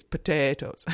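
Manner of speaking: "potatoes" said the Irish way; Irish accent. Retold or imitated. Lecturer showing a memorable keyword to students, Irish accent. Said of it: "that's the Irish accent".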